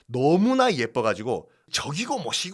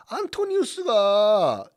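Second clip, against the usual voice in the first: drawn-out name; 6 semitones higher, 5 dB louder, much slower than usual. Shown as a demonstration. Emphatic name to class.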